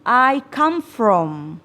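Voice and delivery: "I come from" slowly with "kam"; slow correct model. Shown as a demonstration. (ucapkan pelan dan benar)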